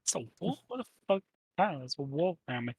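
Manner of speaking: muffled behind a door; through a wall. Pretend. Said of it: "There's a very muffled voice".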